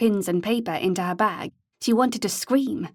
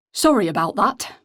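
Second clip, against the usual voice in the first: put-on friendly northern English accent, a bit louder; easy northern English apology; pleasant northern accent; 4 dB louder than usual. A narrator's reading. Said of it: "in a pleasant northern accent".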